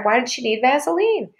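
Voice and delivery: plain reading voice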